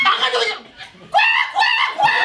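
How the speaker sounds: high, loud scolding yell; mock angry shout. Drama teacher scolding a laughing spectator, loud. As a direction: snap at the giggling class.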